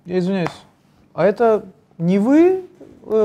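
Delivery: ordinary talk, nothing marked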